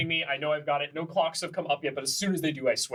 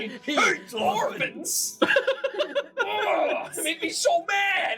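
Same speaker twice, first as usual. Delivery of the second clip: laughing mock outrage; much slower than usual.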